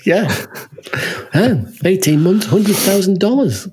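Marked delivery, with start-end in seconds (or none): none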